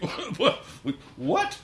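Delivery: recalling the moment he overheard his partner, rising disbelief. Retold or imitated. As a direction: ramp up the shock with every "what". Stunned by overheard question.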